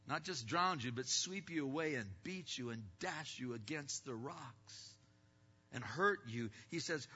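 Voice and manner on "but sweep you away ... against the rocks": ordinary talk, nothing marked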